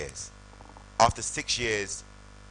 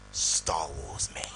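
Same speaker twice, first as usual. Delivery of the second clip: hushed, breathy reveal of a secret; dramatic whisper; 6 semitones lower, much slower than usual. Retold or imitated. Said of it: "Femi likes to be dramatic".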